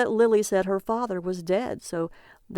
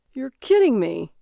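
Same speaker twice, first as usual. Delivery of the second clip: louder, higher excited cry; cried out; 4 semitones higher, 6 dB louder than usual. A narrator's reading. Husband cries out at the naked man, thrilled. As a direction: burst out with glee. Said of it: "Barry cried out, laughing wildly".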